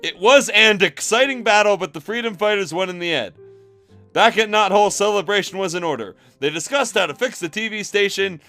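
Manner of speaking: usual speaking voice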